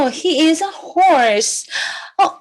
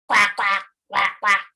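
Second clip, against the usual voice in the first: duck quacking; 8 semitones lower than usual. Pretend. Teacher imitates the duck.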